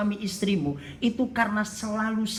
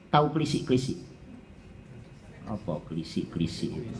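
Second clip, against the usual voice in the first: ordinary delivery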